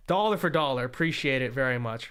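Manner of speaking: usual speaking voice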